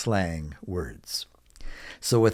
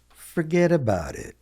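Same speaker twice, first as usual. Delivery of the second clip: "Forget about it" pitched up, then falling; casual model phrase; 5 semitones higher than usual. Shown as a demonstration. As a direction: toss off "Forget about it" high, at natural pace. Teacher models phrase, then slower.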